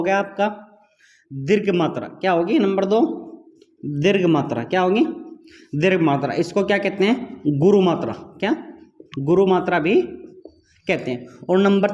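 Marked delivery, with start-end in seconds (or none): none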